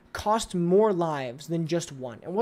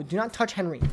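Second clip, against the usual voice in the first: ordinary talk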